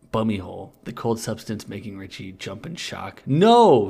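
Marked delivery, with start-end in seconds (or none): 3.2-3.9 high, loud mock wail. A narrator's reading. Richie recoiling from the cold, loud high wail. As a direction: wail it out in hammed-up protest. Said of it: "he wailed".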